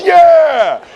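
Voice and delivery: group shout. Retold or imitated. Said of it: "And everybody goes".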